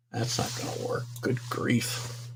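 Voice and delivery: whispered grumble to himself; real frustrated whisper aside; muttered under breath. Real, not acted. Muttering to himself over tech trouble.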